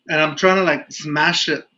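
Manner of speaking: usual speaking voice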